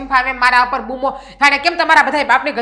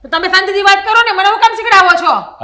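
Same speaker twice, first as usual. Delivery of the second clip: loud, high shouted retort; wife shouting back at husband, furious; angry shout; 6 semitones higher, 7 dB louder than usual. Acted. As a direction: shout his own words back.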